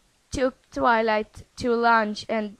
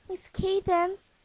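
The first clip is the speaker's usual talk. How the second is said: guilty little filly; 8 semitones higher, 3 dB quieter than usual. Acted. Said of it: "said guiltily".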